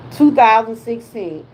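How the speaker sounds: ordinary talk, nothing marked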